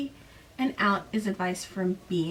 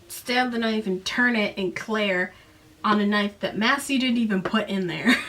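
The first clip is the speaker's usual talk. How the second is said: ordinary delivery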